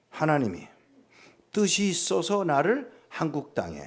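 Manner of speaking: ordinary talk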